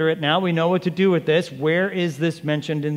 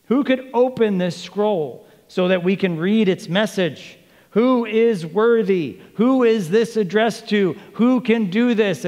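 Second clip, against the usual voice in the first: high, loud, ringing appeal; proclaimed question; 6 semitones higher, 5 dB louder than usual. Acted. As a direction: call it out to a whole assembly.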